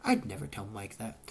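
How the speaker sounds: ordinary delivery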